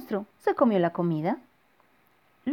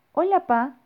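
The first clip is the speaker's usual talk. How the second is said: put-on cheerful child voice; 8 semitones higher, 6 dB louder than usual. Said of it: "dijo Bernardo".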